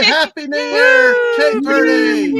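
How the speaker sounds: loud, high New Year cheer; cheering shout. Real, not acted. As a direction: shout it out in celebration.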